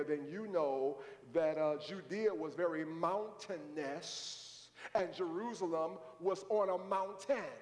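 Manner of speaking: normal talking voice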